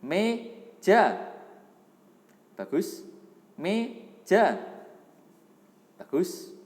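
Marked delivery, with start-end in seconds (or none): 0.0-1.3 loud, high model word to repeat; sounding out words. Shown as a demonstration. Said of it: "Tirukan ya". 3.6-4.6 loud, high model word to repeat; sounding out words. Shown as a demonstration. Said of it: "Tirukan ya".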